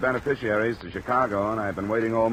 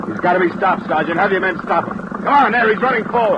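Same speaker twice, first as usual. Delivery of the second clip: loud, higher, pressing commands; urgent orders; 9 semitones higher, 7 dB louder than usual. Acted. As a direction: drive the sergeant to act right now. Urgent orders during a fight.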